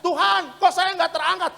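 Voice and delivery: high, loud mock outcry; imitated cry to God. The left-behind crying to God, imitated shouting. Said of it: "teriak-teriak".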